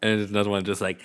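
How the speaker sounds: ordinary talk, nothing marked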